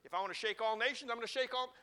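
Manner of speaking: preacher swelling to a climax; building declaration. Acted. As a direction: build it up like a proclamation. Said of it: "him saying to these people is this".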